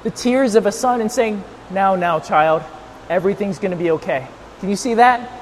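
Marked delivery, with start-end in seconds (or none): 1.7-4.2 low, soft, tender reassurance; soothing mother. Acted. Mother wiping the tears of a son, soothing. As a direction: comfort a crying child gently. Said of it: "like, a mother wiping the tears".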